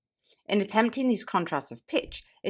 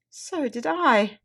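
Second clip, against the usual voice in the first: slow, high punchline; 7 semitones higher, 4 dB louder, much slower than usual. Acted. Punchline of comic poem.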